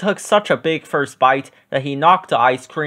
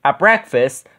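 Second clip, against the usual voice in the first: ordinary delivery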